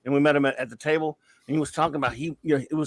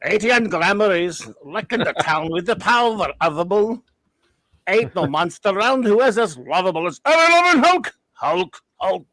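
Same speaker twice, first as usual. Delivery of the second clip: higher sung theme, mock Scottish accent; Scotty impression; 4 semitones higher than usual. Fan performs Hulk theme for friends, Scottish accent. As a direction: roll out the verse in a Scottish burr, big finish. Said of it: "do the Hulk one as Scotty".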